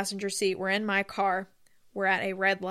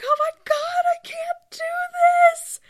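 high-pitched, louder, squeaky wail of panic; retold crying breakdown; 8 dB louder than usual. Stuck in the intersection, imitating her own wailing breakdown. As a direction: replay the moment you fell apart at the wheel. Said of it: "I start crying".

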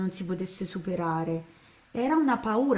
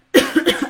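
loud cough; 8 semitones higher, 12 dB louder than usual. Real, not acted. Sudden cough mid-sentence.

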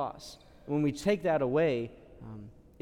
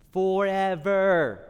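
Forever stretched long and louder; drawn out; 3 semitones higher, 6 dB louder, much slower than usual. Acted. Priest stressing the soul's eternity, Forever drawn out. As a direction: lean hard on Forever, slowly.

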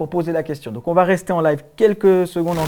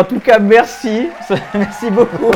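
higher voice breaking into laughter; laughing thanks; 6 semitones higher, 5 dB louder, slower than usual. Real, not acted. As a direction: thank them through a laugh. Rit en sortant du sketch. (merci en riant)

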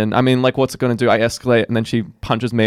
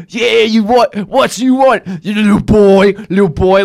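loud, high, belligerent caricature; hammed-up heckling; imitating crackhead lady; 9 semitones higher, 8 dB louder, slower than usual. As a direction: square up as an aggressive addled woman. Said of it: "bumbles over to me and she's like".

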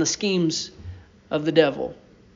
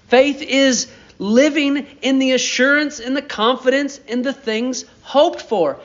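full-force preaching; 4 semitones higher than usual. Acted. Preacher rallying the congregation about trusting God, fervent. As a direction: preach it, rising with conviction.

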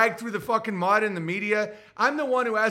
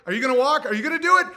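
higher, louder pushy question; mock challenge; 6 semitones higher, 6 dB louder, much faster than usual. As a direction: press them, building up. Acted argument over pizza.

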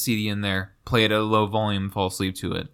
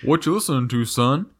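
lightly voiced father questioning his son; a hint of a cartoon father; mock cartoon dad; 4 semitones higher than usual. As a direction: put on a slightly raised dad voice. Acts cartoon father to Bobby.

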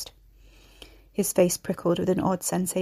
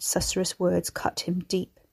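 usual speaking voice

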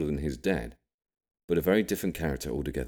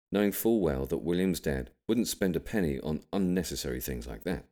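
ordinary delivery

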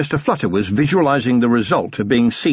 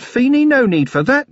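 higher, louder, hammed-up restraint; mock alarm; 3 dB louder than usual. A narrator's reading. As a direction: stop Feeney with theatrical urgency.

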